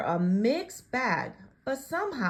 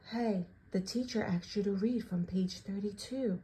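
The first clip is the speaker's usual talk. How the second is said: softer, hushed aside; whispered; 2 semitones lower, 7 dB quieter than usual. Acted. Classmate leaning in to tip off the narrator, hushed. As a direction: lean in and say it softly. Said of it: "leaned in close and whispered".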